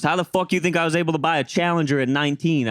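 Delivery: high-pitched imitation of a defensive coworker; mock indignation. Coworker defends himself in retold quarrel.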